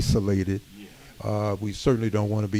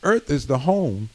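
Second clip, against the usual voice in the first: rising preaching; 3 semitones higher than usual. Acted. Minister exhorting the community room, preaching with rising force. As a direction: preach it to the congregation, building.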